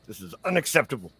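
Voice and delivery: lightly voiced grumbling old captive, rising at the end; mildly hammed-up outrage; indignant old man. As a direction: sputter in protest as an affronted old man.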